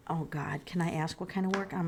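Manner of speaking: breathy under-the-breath oh God; nervous whisper. Real, not acted. To herself, worried about getting in trouble, whispered. Nervous aside over the reading.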